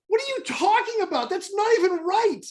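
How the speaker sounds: high-pitched indignant disbelief; retold screaming. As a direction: protest in a high, exasperated voice. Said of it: "I'm screaming at my phone".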